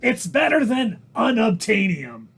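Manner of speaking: emphatic put-on comedian voice; exaggerated impersonation with strained peaks and falling endings; Gilbert Gottfried. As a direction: argue it as an abrasive comic. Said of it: "we're gonna talk like this".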